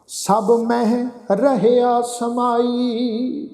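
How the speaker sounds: slow recitation with long held final syllables; measured level-toned sacred reading; scripture recitation. Acted. Teacher recites Gurbani to the congregation, slow scripture recitation.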